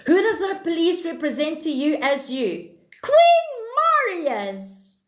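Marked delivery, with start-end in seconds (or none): 3.0-5.0 much higher and louder burst on "Queen"; sudden thrilled greeting; excited shout-out. Real, not acted. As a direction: call out the name with delight. Excitedly reads out a viewer name.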